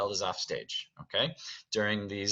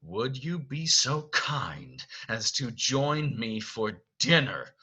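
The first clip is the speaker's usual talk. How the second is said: strained politeness; 2 semitones higher, slower than usual. Acted. Beast forcing politeness at door.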